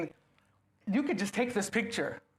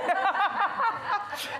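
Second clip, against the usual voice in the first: laugh; 13 semitones higher, 4 dB louder than usual. Real, not acted.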